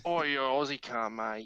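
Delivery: a hint of Australian accent, slow; slow mock Aussie banter. Joking with a friend, slow mock Australian accent. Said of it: "your Australian accent is".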